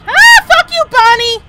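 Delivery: shrieking jump scare at Bonnie; startled high scream; terrified shriek; real scream. Real, not acted. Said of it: "I squeaked".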